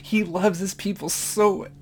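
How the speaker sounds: high, breaking voice, crying laugh; overcome with emotion. Real, not acted. Preacher overwhelmed by God's love, crying. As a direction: voice cracking as tears come. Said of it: "had a real moment there".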